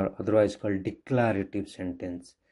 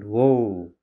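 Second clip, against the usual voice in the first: loud, drawn-out demo exclamation; sudden emotion; 6 dB louder than usual. Shown as a demonstration. Teacher showing children an interjection, exclaimed loudly. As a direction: exclaim "Whoa" louder, as an example. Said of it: "used to express strong feeling or sudden emotion".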